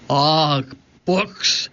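disgusted pirate captain's growl. A narrator's reading. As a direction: growl it lightly in disappointment. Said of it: "growled Cap'n Bones".

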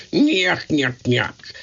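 imitating Curly's nyuk nyuk chuckle. Curly chuckling after a slapping, mock nyuk laugh. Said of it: "Curly said".

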